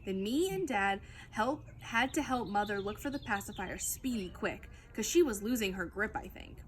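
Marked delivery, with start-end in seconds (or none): none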